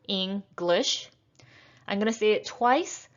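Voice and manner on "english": English split into Eng-glish, rising at the end; syllable by syllable. Shown as a demonstration. Teacher breaking down English for learners, syllable by syllable. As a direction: separate English into two beats. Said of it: "we have two syllables".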